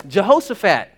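ordinary talk, nothing marked

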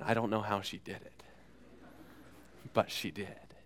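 ordinary talk, nothing marked

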